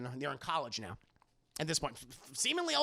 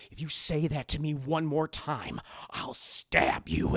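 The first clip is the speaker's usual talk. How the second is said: slow, menacing mock warning; mock threat; 3 semitones lower, slower than usual. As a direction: draw out the threat, dropping very low at the end. Acted threat to another student.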